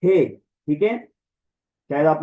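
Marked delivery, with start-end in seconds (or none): 0.0-0.2 a clear breathy हे, just the h sound; हे said as a plain aspirated h syllable; h sound demo. Shown as a demonstration. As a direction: show the rough breathing as हे. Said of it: "हे की sound निकालेगा rough breathing".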